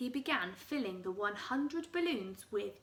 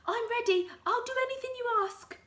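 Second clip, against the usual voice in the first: high, fast desperate voice; eager Mrs Twit; 12 semitones higher, 7 dB louder, faster than usual. Acted. Wife pleading to be cured, high and fast. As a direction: gabble it high and frantic. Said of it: "cried Missus Twit".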